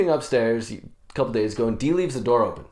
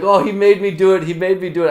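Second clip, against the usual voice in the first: raised, louder hasty protest; mock pleading excuse; 6 semitones higher, 6 dB louder than usual. Driver to angry pedestrian, protesting. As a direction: pass the blame in a lifted voice. Laughing driver blaming his passenger.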